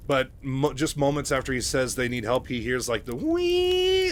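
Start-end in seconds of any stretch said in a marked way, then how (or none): 3.1-4.1 high, piercing mock whine of a hover bike; imitated engine whine. Narrator mimics the Vulture bike arriving, high mock whine. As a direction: squeal it like a hover bike zooming in. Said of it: "the whine of the Vulture".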